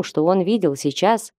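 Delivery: usual speaking voice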